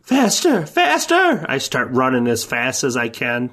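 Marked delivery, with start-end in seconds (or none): 0.0-1.4 loud, high, urgent cries; urgent shout. Acted. Urging the group on while fleeing the cold, loud high shouts. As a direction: yell it as you run for your life.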